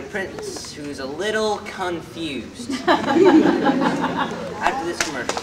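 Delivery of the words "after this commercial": slow, booming announcer tag. Acted. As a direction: drag it out like a TV announcer. Announcer tease before break.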